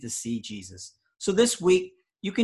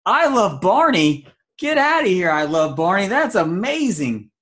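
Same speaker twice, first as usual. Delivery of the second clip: high, loud delighted exclaiming; excited; 5 semitones higher, 6 dB louder than usual. Real, not acted. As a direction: gush with overjoyed disbelief. Reacts to absurd rating.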